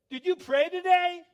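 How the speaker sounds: very high, booming challenge; loud, obnoxious buddy. Retold or imitated. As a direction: holler it accusingly across the water. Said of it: "loud, kind of obnoxious guy".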